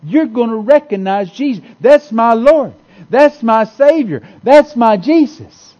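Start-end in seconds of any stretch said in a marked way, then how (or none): 1.8-5.7 slow and loud, building with joy; thrilled, louder on each line; excited recognition. Retold or imitated. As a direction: cry it out like you finally see him. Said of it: "you're gonna be so excited".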